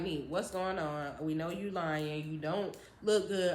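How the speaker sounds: lightly voiced calm stepdad. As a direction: ask gently but firmly.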